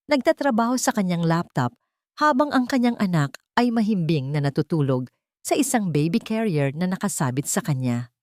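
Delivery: ordinary delivery, nothing marked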